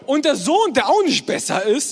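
ordinary talk

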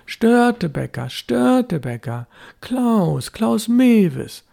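high, carrying call of the names; calling out across the dike. A narrator's reading. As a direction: call the names out over the water. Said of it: "schallte es über den Nestdeich".

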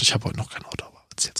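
soft whispered aside. Real, not acted. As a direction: whisper it as a confession. (geflüstertes Geständnis)

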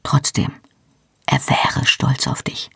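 tender whisper to comfort him; soft whisper. A narrator's reading. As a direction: whisper it gently, meaning it this time. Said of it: "sagte dann leise".

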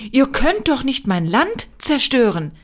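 a touch of a general's roar; bellowing general. A narrator's reading. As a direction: roar as an outraged general, without full volume. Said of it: "brüllte der General".

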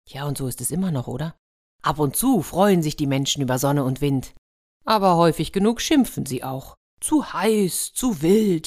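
lively storyteller reading a fairy tale; playful storyteller; story narration. A narrator's reading. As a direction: tell it like a bedtime story to children. Fairy tale read aloud.

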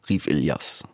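ordinary delivery

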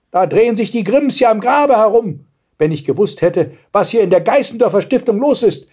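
high-pitched, scolding tirade; ranting grandmother. A narrator's reading. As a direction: rant on like an outraged grandmother. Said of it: "schrie die Großmutter". (schimpfende Großmutter, hohe Stimme)

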